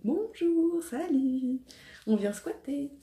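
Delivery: high, bright mock greeting from pimples; cheeky pimples. Pimples greeting her face, playful high mock voice. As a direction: voice the spots barging in cheerfully.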